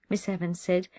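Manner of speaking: usual speaking voice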